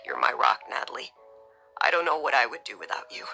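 overcome with emotion. A narrator's reading. Husband to wife after kissing her, low and emotional. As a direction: murmur it low, moved. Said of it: "Bryce, overcome with emotion".

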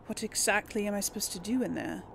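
normal talking voice

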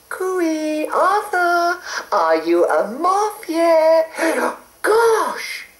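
high fluttery fairy calling; a sweet high fairy voice. A narrator's reading. Fairy Mavis calls out.

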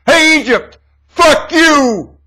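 high, loud yelled curse; shouted taunt. Acted. Speaking for George Bush to Egypt, a loud yelled taunt. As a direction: hurl it at them full force. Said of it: "So I'll speak for him".

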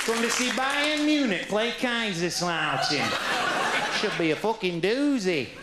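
put-on Germans in a Yorkshire accent, high and slow; Yorkshire voice, high and drawn out. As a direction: play a slow Yorkshireman chatting football. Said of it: "they all talk like that".

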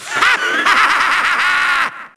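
long rattling villain laugh; theatrical rapid-fire evil laughter; evil cackle. Acted. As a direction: cackle in gloating triumph. Villain gloats.

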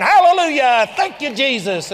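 high, loud, hollered praise; imitated praise shout. Preacher imitating unbelievers on the basketball court, hollered praise. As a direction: cry it out like unbelievers playing at praise. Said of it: "Here was unbelievers going".

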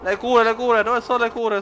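high, loud call for heals; frantic shout. Real, not acted. Gamer searching for heals mid-fight, high loud shout. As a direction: cry out for the healing items. Begging for healing in game.

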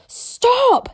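high, loud yell. A narrator's reading. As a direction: yell it out in alarm as the boy. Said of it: "yelled Hiccup".

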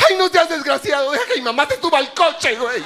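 high whiny wife voice, mocking; wife's voice. Wife scolding husband at the safari, high whiny mock voice. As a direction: squeal it like a nagging wife. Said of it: "mi mujer me dijo".

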